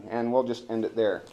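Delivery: ordinary talk, nothing marked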